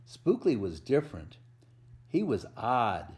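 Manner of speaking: lively picture-book read-aloud with a bouncy rhythm; reading to children. A narrator's reading. Volunteer reading a Halloween book to kids, storytelling voice.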